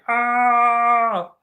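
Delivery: high, strange troll cry; a put-on troll yelp on stream. Viewer asks for the troll voice, so he yelps. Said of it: "get my troll voice on".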